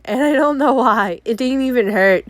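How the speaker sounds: normal talking voice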